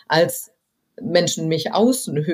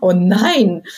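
higher, rising mock dismay ending in a louder groan; light comic despair at the truth on tape; 4 semitones higher, 4 dB louder than usual. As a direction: play the horror of hearing your own recording. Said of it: "dass man sagt".

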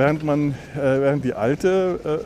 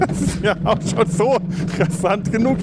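excited; 8 semitones higher than usual. Real, not acted. Commenting during the ride.

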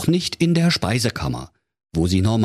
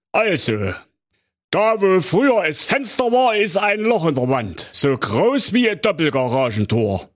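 Kommissar Bärbel Ehrlicher; 11 semitones higher than usual. Acted. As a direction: drag it out like a smug small-town cop. Commissioner reports.

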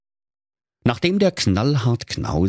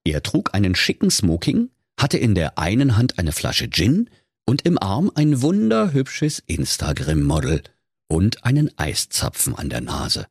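ordinary delivery

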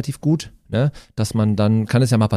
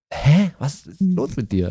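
arrogant cat; 4 semitones higher, slower than usual. Acted. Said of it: "so arrogant".

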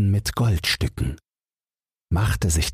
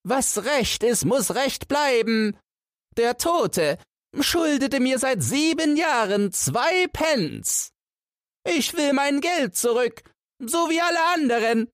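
put-on old woman calling. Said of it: "lief ihnen eine alte Frau hinterher und rief".

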